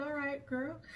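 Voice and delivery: high, soft consoling voice; soothing friend. Retold or imitated. Friend comforting a crying woman after her retort, soothing. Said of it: "she was just rubbing her".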